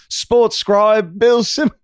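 slow, high mock British newsreader accent. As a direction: ham up a British announcer accent, slowly. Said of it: "I wish I could do a good accent".

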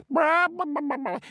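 high excited hen clucks; clucking hen. Acted. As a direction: cluck like a delighted chicken. Sister Chicken clucking excitedly.

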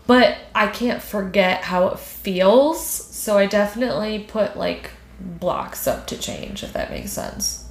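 slow tearful words fading to a whisper; holding back sobs, trailing off; crying voice. Real, not acted. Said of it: "your crying voice is iconic".

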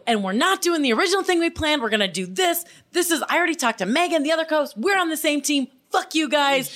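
high, insistent mock rant; retold angry outburst. Telling the co-hosts the plan changed, hammed-up anger. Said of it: "I went from anger".

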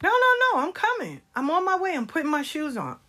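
high-pitched, hurried reassurance; hasty placating. Retold or imitated. Grandmother assuring grandchild she is coming, hasty placating.

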